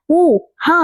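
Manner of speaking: boxing grunts. A narrator's reading. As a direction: grunt with each pretend punch. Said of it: "అంటూ ఆడుకుంటుంటే".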